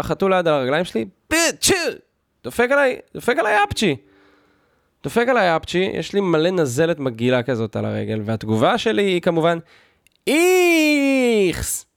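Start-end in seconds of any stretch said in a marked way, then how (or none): 1.3-1.7 imitated sneeze. Cat sneezing on his legs. 2.4-4.0 exclaimed indignantly. Retold or imitated. Retelling the cat's sneeze. 10.3-11.9 a long, drawn-out high "איכס" in a strange voice; high-pitched disgust. Retold or imitated. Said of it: "עשיתי את זה בקול יותר גבוה".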